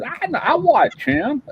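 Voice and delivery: high, slow, sheepish little voice; mimicking the husband. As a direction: squeak it out like a shy fan. Mimicking the husband's proud reply.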